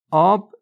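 آب said loud and high, punched; emphatic. Real, not acted. Preacher revealing water as the cure for anger, emphatic. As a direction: ring out آب as the answer.

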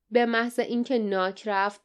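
ordinary delivery, nothing marked

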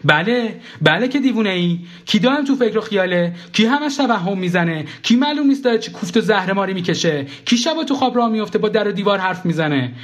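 high, insistent tirade; heated scolding. Acted. Ex-wife lists narrator's crazy habits to his face, heated scolding. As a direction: pile up the accusations as sharp rhetorical questions.

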